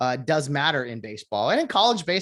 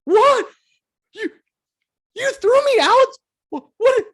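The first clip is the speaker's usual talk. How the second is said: high, loud cry of disbelief; a hitter shocked to be ejected, yelling in disbelief; mock shocked yell; 10 dB louder than usual. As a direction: shout it like you can't believe you're thrown out. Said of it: "The hitter then goes".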